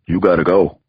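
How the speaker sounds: lightly voiced Brooklyn lawyer; brusque send-off with a Brooklyn accent; a touch of Brooklyn. A narrator's reading. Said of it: "the lawyer said in his Brooklyn accent".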